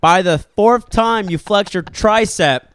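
loud and slow, hammering each word; loud emphasis. Real, not acted. Telling his friend about the tricep flexing, loud and slow. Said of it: "I'm getting loud 'cause I wanna emphasize".

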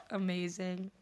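ordinary talk, nothing marked

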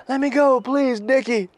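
very high, loud imitated begging; high-pitched mock plea; pleading captive. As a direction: repeat it obediently in a high put-on voice. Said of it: "And the guy goes".

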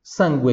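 sangue as "SAN-gweh"; Italian pronunciation. Shown as a demonstration. Teacher models the word, sangue in Italian with "gw". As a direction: sound the U and open the final E in sangue. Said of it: "em italiano".

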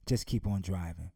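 low, calm and steady; father calmly reassuring his daughter in the storm. Acted. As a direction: reassure the frightened child. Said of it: "just as calm and said".

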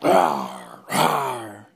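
deep roar; lion roar. Acted. Blocked lion roars at the elephant. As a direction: roar but hold back.

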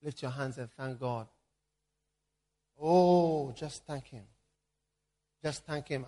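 soft, hushed prayer over music; soft worship leading. Acted. Pastor leading the congregation in quiet worship, hushed and prayerful.

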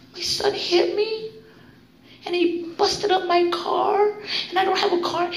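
milk each misfortune in a mock-pitiful voice; professional victim. Recovering addict parodying own complaints about their son, mock self-pity. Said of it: "My professional victim".

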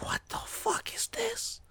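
slow, hushed whisper of amazement; awed whisper. Retold or imitated. Kid amazed at his first cinema film, awed whisper. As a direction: whisper it slowly, as if awestruck. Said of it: "I was so amazed".

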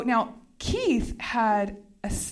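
ordinary talk

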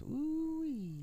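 squeaky high whoop; high squeal. Acted. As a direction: let out a high-pitched ooh-wee. Playful exclamation, very high.

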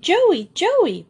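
high, loud shrieking call of a name; wild shriek. A narrator's reading. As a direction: shriek it out in alarm. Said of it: "A wild shriek of".

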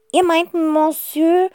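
high, loud, slow grand countess. Acted. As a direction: ask like a grand lady. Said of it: "fragt die Gräfin". (hohe, laute Gräfin)

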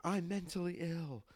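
slow, high, put-on shriek; mock scream. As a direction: act out the meltdown slowly. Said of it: "I start screaming at her".

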